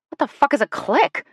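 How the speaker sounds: high, loud outburst; half shout it, baffled. A narrator's reading. Jill baffled by the guide's jargon, half shouting. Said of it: "Jill half shouted".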